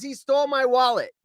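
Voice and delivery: imitated cry for help, high and loud; retold scream. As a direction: call out like the robbed cashier. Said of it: "She screams".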